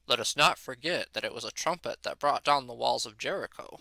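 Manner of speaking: low, solemn deadpan priest; mock-solemn pulpit deadpan, dropped low; deadpan priest impression. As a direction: intone it gravely, deep and dry. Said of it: "said in this super deadpan voice".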